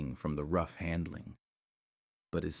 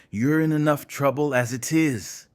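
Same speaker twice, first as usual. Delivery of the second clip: loud, raised, menacing warning; growled threat; 7 semitones higher, 8 dB louder than usual. A narrator's reading. Rick threatens the man on the ground, growling. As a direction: come down hard on him. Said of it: "Rick growled".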